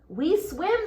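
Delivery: high small voices; baby fish answer together; little fishes. Acted. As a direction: chime it like two baby fish. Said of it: "said the two".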